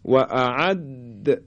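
"اعد" slow, د held then bounced; qalqalah on dal. Shown as a demonstration. Teacher giving dal its qalqalah, "اعد" with bounced د. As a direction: hold the د of "اعد", then release with an echo. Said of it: "قلقله بدیم".

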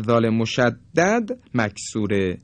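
ordinary talk, nothing marked

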